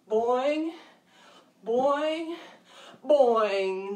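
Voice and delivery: each one a bouncy hop; kangaroo boings. Shown as a demonstration. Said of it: "Let me hear some kangaroo sounds".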